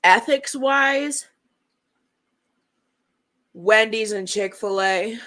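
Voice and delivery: talking while chewing ice; words around crunching ice; ice in mouth. Real, not acted. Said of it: "sorry, I'm chewing ice in y'all ear".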